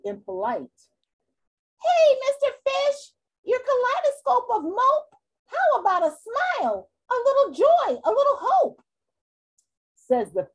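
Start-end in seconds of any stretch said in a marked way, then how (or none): 1.8-8.8 very high, slightly cheeky squid voice; squeaky squid. Acted. Impolite squid to the moping fish, very high.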